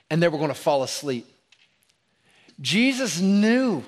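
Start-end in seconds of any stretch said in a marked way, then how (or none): 2.6-3.8 higher, louder, slow Jesus knew; preacher's rising refrain on "Jesus knew"; building emphasis. Acted. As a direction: lean hard on "Jesus knew".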